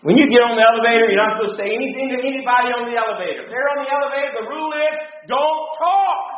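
high-pitched mock lecture, rushed at first; imitating scolding wife. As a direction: rattle off the rule like an exasperated wife. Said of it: "She's just like".

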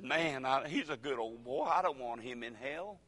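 usual speaking voice

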